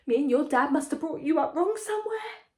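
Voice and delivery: hammed-up bawling mother; a mother's mock wail; mock bawling mum. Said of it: "She's usually bawling when she's saying this".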